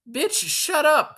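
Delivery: high, loud, slow shriek of bitch shut up; mock shrieking mean girl. Quoting a mean girl in a high drawn-out screech. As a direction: screech it like a nasty teen. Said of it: "And she's like".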